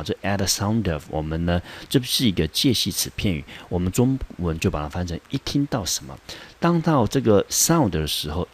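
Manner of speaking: ordinary talk, nothing marked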